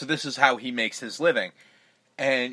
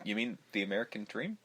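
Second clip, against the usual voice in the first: dry quiet retort; 4 semitones lower, 11 dB quieter than usual. Retold or imitated. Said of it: "And I'm like".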